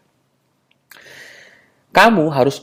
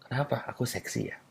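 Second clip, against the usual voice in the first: very soft, almost whispered tease; teasing murmur; 15 dB quieter than usual. Acted. Man teasingly accusing a woman of finding him sexy, teasing murmur. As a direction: drop to a hushed, suggestive question.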